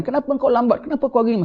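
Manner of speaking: high, accusing questions; blaming relative. Acted. Grieving family blaming the doctor, high and accusing.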